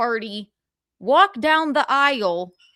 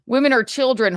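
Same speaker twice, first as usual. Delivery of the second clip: ordinary talk, nothing marked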